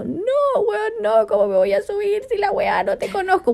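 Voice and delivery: high-pitched mock-tearful protest; imitated crying refusal. Imitating herself refusing the driver's lift, tearful. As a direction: wail it in a high little voice. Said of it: "Yo lloraba como imbécil".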